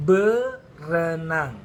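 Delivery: "Berenang" drawn out slowly, each syllable clear, be-re-nang; model word slowly. Shown as a demonstration. Teacher modelling "Berenang" for students to repeat, slow and clear. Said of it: "Come on".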